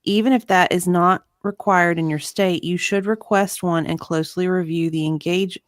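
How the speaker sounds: usual speaking voice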